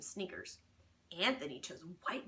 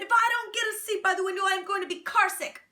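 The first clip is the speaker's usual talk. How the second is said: high, loud and fast, demanding; loud whining complaint; 10 semitones higher, 10 dB louder, faster than usual. Acted. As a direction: whine it loud and fast like a kid. Alexander complains loudly.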